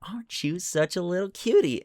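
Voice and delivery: put-on high baby-talk coo; sweet high pet voice. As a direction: coo at the little creature. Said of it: "kinda just like".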